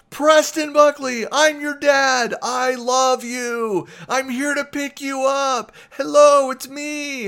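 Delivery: loud, high, stretched-out dad holler; yelling repeatedly. Retold or imitated. Dad yelling love at his teenage son, high embarrassing holler. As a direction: keep hollering to embarrass him in public. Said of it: "I start yelling repeatedly".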